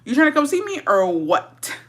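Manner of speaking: insistent repeat of the invite; pushier invite. Retold or imitated. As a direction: push the question harder. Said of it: "I was like".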